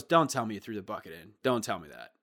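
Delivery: low, quick, deadpan groan of disbelief; weary front desk guy. Retold or imitated. Front desk guy to the kid who threw the bucket, low deadpan dismay. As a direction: sigh it out like he's seen it all. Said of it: "He just goes".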